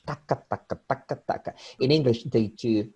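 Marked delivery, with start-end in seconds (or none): 0.0-1.5 "taca, taca" in equal rapid beats, softly; soft syllable-by-syllable "taca taca"; Spanish-like even rhythm. Shown as a demonstration. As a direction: rattle off "taca taca" evenly and under the voice. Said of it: "it's not like Spanish".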